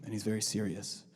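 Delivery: low, very serious character voice; Sir Night-Eye. Acted. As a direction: drop deep and sober, all business. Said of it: "he just talks like this all the time".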